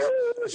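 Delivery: sharp, high mock gasp; shocked gasp. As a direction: gasp as if scandalized. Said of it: "Everybody's like".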